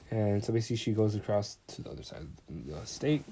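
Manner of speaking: normal talking voice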